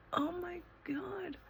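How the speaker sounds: breathy whisper of realisation; whispered oh my God. Real, not acted. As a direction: whisper it in shock. Stunned realisation, whispered aside.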